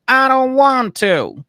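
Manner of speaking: put-on high, slow, stubborn old lady whine; a put-on whiny refusal; grumpy mom voice. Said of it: "she was like".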